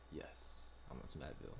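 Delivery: whispered eagerly; breathy pleading whisper. Retold or imitated. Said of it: "I was like".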